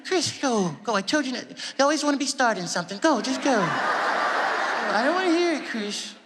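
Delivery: high, fretful dismissal; gently exasperated high voice. Retold or imitated. [name] refusing [name]'s apology and sending him off, exasperated. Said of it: "And [name] was like".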